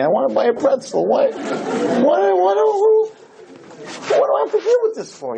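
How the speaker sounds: high, whiny what what what; mock despair at God; half-comic frustrated wail to Hashem; exasperated plea.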